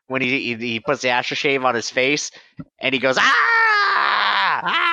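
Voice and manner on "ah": mimicked baby scream. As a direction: shriek like the baby in the movie. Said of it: "mimicking the baby from that movie".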